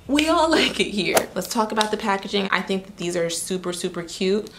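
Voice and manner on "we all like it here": breaks into a laugh, higher and louder; a real laugh bursting out mid-line. Real, not acted. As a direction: laugh through the words. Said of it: "And I know you like it here".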